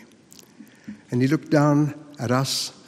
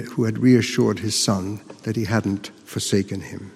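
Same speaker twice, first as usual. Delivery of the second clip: ordinary delivery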